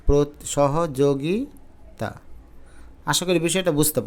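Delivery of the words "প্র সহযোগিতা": সহযোগিতা drawn out very slowly, প্র held apart first; slow careful spelling. Shown as a demonstration. Teacher slowly reading the correct spelling সহযোগিতা to the class. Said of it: "এটা বানানটা হবে এরকম".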